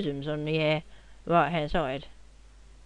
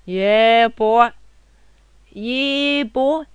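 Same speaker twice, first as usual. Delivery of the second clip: loud, higher hype shout; hype yell; 5 semitones higher, 8 dB louder, much slower than usual. Real, not acted. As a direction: yell it like you just won. Gamer hype yells.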